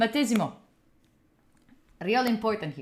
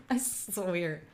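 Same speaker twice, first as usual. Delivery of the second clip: muttered aside; 6 semitones lower, 12 dB quieter, slower than usual. Real, not acted.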